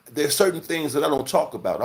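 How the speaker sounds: usual speaking voice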